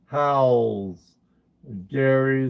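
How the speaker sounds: ordinary delivery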